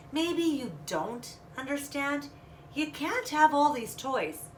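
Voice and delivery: put-on mother gently reasoning with her child; patient mother. Mother patiently explaining to her daughter, slowly.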